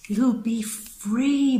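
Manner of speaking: free stretched long and high; lingering dreamy free; drawn-out sing-song. Real, not acted. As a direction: savour the word free, slow and lifted. Repeats freer, drawn out high.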